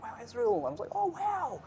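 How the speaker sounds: high, breathy fake awe; fake excited gamer. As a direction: gush in a high fake-impressed voice. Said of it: "it's like".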